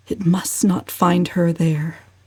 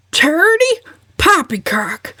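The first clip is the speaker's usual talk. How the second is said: high scornful snort; 10 semitones higher than usual. A narrator's reading. Uncle Benjamin scoffing at his cousin, scornful snort. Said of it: "snorted Uncle Benjamin".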